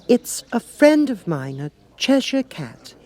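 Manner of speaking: put-on high, slow girlish voice; a young girl proudly introducing a friend; Alice voice. Alice introduces the Cat to the King, high and girlish.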